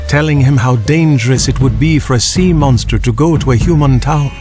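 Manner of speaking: ordinary delivery, nothing marked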